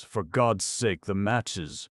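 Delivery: desperate plea. Acted. Sitter to Deacon, a desperate plea. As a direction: beg for the matches.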